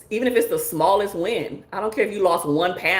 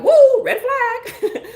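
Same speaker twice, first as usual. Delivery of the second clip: much higher, slow, laughing cry of alarm; mock alarmed woo; 4 dB louder, much slower than usual. Host playing a partner who spots a red flag, laughing mock alarm. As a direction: stretch the woo out like a siren, laughing. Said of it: "It can turn red and be like".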